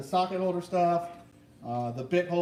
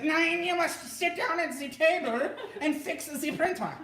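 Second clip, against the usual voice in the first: higher, bossy put-on German accent, laughing through it; mock German accent; 10 semitones higher than usual. Wife ordering husband to fix the printer, mock German accent. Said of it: "You know, I'm German heritage".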